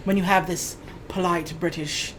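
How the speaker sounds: low, soft, polite British accent; Hugh Grant. Shown as a demonstration. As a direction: lightly put on a refined British accent, quietly. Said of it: "Hugh Grant kind of accent".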